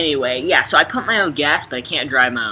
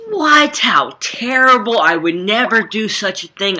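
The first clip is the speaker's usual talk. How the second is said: mock outrage; 7 semitones higher, 6 dB louder than usual. Mock outrage at cow tipping.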